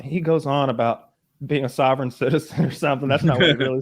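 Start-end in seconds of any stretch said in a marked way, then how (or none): none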